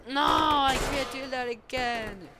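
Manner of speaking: loud, high-pitched cry of protest; pretend outcry. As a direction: cry it out like a character in trouble. Character protests during pretend play.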